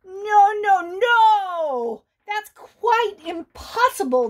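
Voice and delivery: loud, wailing no no; distraught bunny. Acted. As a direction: refuse in dismay. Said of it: "the Easter Bunny continued".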